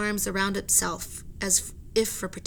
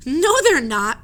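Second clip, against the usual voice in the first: loud, higher protest; protesting cry; 6 semitones higher, 10 dB louder, slower than usual. Acted. Annie cries out to the sailors, loud. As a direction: cry out against them. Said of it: "cried Annie".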